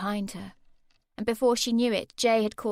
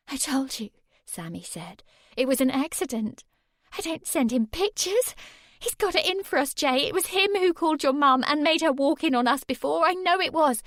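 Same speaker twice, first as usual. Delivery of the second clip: put-on high, louder anxious girl; insist, desperate to be believed; pleading Sammy; 6 semitones higher, 5 dB louder than usual. A narrator's reading. Sammy pleads with Jay to believe her.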